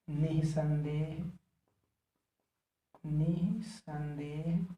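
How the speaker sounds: long drawn-out syllables; lingering writing-along pace; slow dictation. Real, not acted. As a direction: stretch निसंदेह slowly, syllable by syllable. Sounding out an example while writing.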